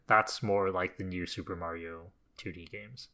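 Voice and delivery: usual speaking voice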